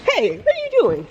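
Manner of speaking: high, loud pet scolding; pet talk voice. Real, not acted. Talking to the dog.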